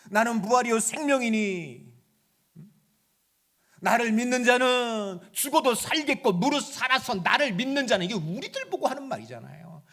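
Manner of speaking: loud, soaring sermon delivery; full-force preaching. Acted. Preacher proclaiming victory over death, loud and soaring.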